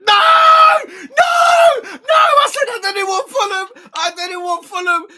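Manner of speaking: screaming no at the draw, high and loud; furious wail at the bad draw; anguished shout. Real, not acted. Fan yelling at the FA Cup draw in despair.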